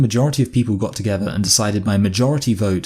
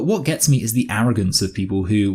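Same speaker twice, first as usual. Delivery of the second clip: usual speaking voice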